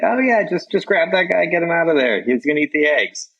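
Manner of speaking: high, breezy imitation of the local guy; mock laid-back local. Friend mimicking the relaxed host's texted advice, high and breezy.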